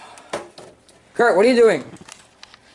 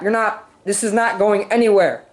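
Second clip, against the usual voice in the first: ordinary talk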